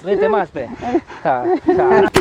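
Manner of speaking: high mocking dare to keep laughing; mocking taunt. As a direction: goad him, pitch flying up. Bully taunts laughing brother-in-law.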